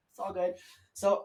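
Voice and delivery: ordinary talk, nothing marked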